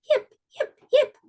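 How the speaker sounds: very high, squeaky fox yips; high little fox calling into the night. Acted. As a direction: yelp like a tiny fox calling out. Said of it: "She called".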